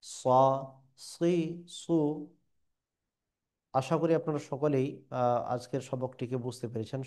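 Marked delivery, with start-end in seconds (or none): 0.0-1.9 স, সি, সু each separate, with heavy emphatic s; drawn-out syllables. Shown as a demonstration. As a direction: say স, সি, সু as Arabic Sad, vowel lengthened. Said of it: "তিন আলিফ টেনে টেনে আমাদেরকে পড়তে হবে".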